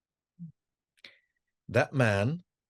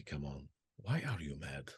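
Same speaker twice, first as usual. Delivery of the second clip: gentle question; 8 semitones lower, 13 dB quieter than usual. Retold or imitated. Sheikh gently asks why angry.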